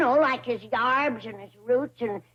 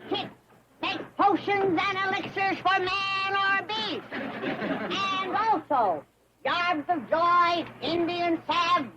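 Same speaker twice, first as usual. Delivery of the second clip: carnival barker drawing in a crowd; street performer; 5 semitones higher than usual. Acted. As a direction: hawk it to passing fairgoers. Medicine show barker pitch.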